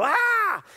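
high mock karate cry; karate yell. Miyagi attacking Daniel with a sudden high karate cry. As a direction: let out a sudden high martial-arts yell. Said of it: "And he goes".

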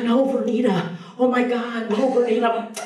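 high, pleading mock alarm; alarmed plea. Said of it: "She's like".

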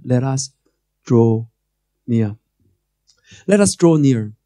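usual speaking voice

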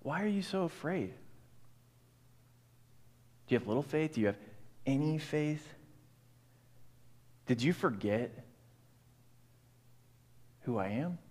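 Jesus gently asking the listener; tender rebuke. Acted. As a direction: ask it tenderly, like a friend. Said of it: "Jesus says the same lines to us".

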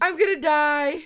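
frightened wail; panicked wail. Real, not acted. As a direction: cry out as you're overrun. Overwhelmed by mobs.

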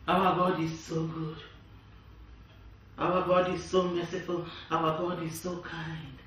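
hushed reverent praise; soft devotional. Real, not acted. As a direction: murmur it in quiet worship. Soft worshipful close.

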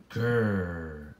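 a low pretend grr; playful growl. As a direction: growl like a little monster. Character growls.

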